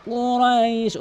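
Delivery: slow, drawn-out chanted recitation; Quran recitation. Shown as a demonstration. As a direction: recite with long stretched vowels. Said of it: "লামকে এক আলিফ টানবেন". (ধীর টেনে টেনে কুরআন তিলাওয়াত)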